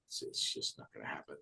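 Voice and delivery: low unvoiced muttered doubt; whispering so the teacher won't hear; whispered aside. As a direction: whisper it under your breath. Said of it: "looking at her going".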